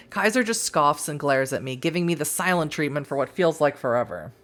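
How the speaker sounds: ordinary talk, nothing marked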